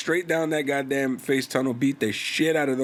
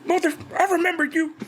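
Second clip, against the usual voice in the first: high, fast mocking falsetto; mock dramatic Kenny; faster than usual. Friend mocking Kenny on a podcast, in a high falsetto. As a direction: ham up a dramatic reunion line, pitched way up.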